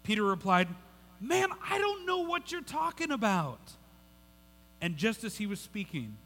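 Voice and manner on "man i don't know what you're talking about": very high-pitched, strained protest; hammed-up desperate outburst; exasperated denial. Reads Peter's third, desperate denial.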